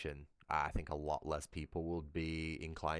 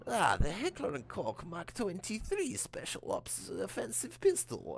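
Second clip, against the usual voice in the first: much higher, slow announcer voice, naming the gun grandly; game announcer impression; 14 semitones higher, slower than usual. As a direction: announce the weapon slowly like a game voice. Quoting the game's weapon announcer.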